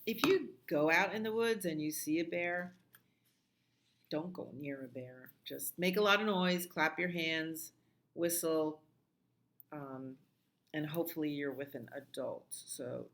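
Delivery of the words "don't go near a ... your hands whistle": ordinary talk, nothing marked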